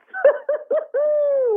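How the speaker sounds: very high, breathy, wheezing laugh. Real, not acted. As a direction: lose it at your own punchline, squealing high. Laughs at mustard pants punchline.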